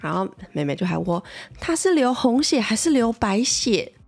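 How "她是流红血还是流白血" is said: put-on slower, higher, earnest little-girl question; little sister solemnly asking mom about her sister's blood. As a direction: ask it the way a serious three-year-old would. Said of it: "很认真、很诚恳".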